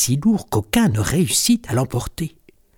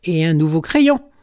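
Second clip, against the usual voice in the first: higher-pitched resentful kitten; lightly voiced grumbling kitten; grumpy complaint; 5 semitones higher, 3 dB louder than usual. A narrator's reading. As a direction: add it as an indignant complaint. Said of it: "rouspète Griffounette".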